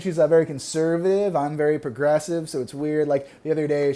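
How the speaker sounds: ordinary talk, nothing marked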